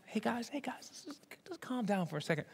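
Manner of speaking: soft, whispered "calm down"; whispered plea to calm down; hushed calming. Acted. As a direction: settle an angry room quietly. Nicodemus calms the Pharisees.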